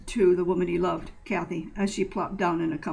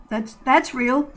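upset insistence; 6 semitones higher, 6 dB louder, slower than usual. Acted. Judy points at the dead bodies.

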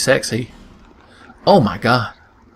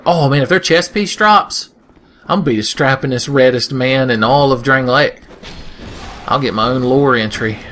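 normal talking voice

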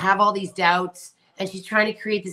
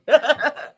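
giggle; 8 semitones higher, 5 dB louder than usual. Real, not acted. Laughing at the comment.

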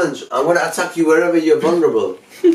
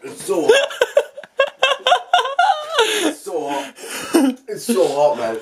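laughing in pain. Real, not acted. Friend burning from chili, laughing.

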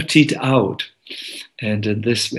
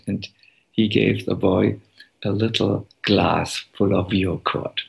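normal talking voice

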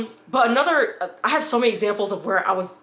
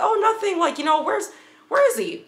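sing-song, very high and airy, hiding her anger; fake innocent; 8 semitones higher than usual. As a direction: act like nothing's wrong, pitch way up. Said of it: "I turn off the madness".

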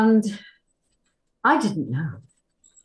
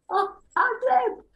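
much higher, squeaky disbelief; mock surprise. Actress retelling her delighted shock at being allowed to ad lib. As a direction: squeal it like you can't believe your luck. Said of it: "they said, "You can ad lib to me".

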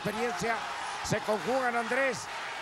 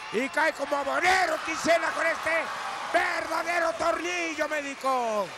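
wrestling commentator; 6 semitones higher than usual. Acted. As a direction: call the fall like a lucha announcer. Excited lucha libre call.

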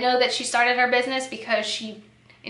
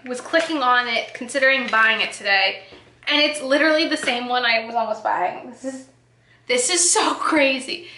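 ordinary talk, nothing marked

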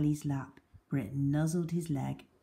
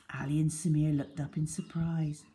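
ordinary delivery, nothing marked

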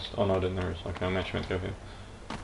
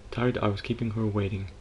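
hushed, gentle painting-show narration; soft painter voice; 8 dB quieter than usual. Acted. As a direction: murmur it like a calm TV art teacher. Bob Ross-style soft painting narration.

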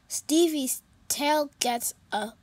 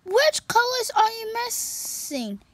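higher, louder bright cat voice; cheerful kitty voice; 9 semitones higher, 4 dB louder than usual. Acted. Pete greeting his sad friend, high and cheerful.

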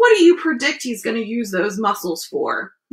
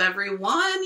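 plain reading voice

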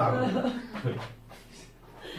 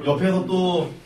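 ordinary delivery

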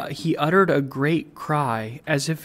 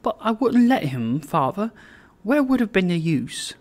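daughter's higher voice, mock complaint; lively young bride; 5 semitones higher than usual. A narrator's reading. Daughter telling her father about her suitor, playful complaint. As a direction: scold him playfully in a lifted pitch.

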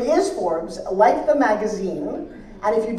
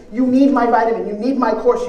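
passionate sales push; 8 dB louder than usual. Retold or imitated. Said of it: "That's what passion means".

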